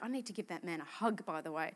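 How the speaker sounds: half-whispered aside, quiet and low. Retold or imitated. Preacher recalls thinking of the man, hushed whisper. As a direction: murmur it to yourself, quick. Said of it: "I was like".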